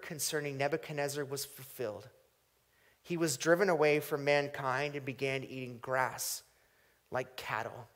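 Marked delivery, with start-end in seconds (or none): none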